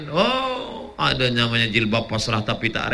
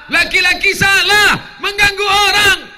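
higher, louder, slow preaching shout; 8 dB louder than usual. Real, not acted. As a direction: thunder it at the congregation. Ceramah menegaskan kesalahan. (teriakan ceramah yang keras dan lambat)

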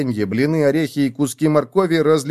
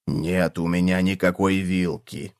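low, slow, glum cockroach; sad low cockroach voice; 5 semitones lower, 4 dB quieter than usual. A narrator's reading. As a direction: drag it out low and disappointed. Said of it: "огорчился таракан".

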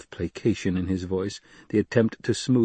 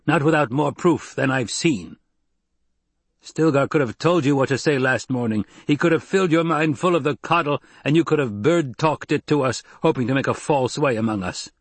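raised, hard accusing voice; a fighter accusing the outsider woman, raised and angry; angry accusation; 6 semitones higher, 3 dB louder than usual. Acted. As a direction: throw the charge at her. Jameis angrily accusing Jessica.

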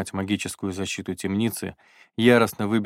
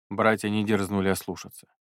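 ordinary delivery, nothing marked

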